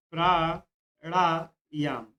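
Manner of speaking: Pranayama drawn out very slowly, syllable by syllable; teacher dictating pranayama slowly to students; slow spelled-out demo. Shown as a demonstration. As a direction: stretch pranayama out for the class to copy. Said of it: "ये बात ध्यान रखिएगा".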